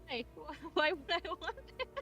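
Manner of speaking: ordinary talk, nothing marked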